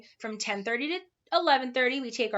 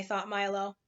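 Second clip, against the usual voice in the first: ordinary delivery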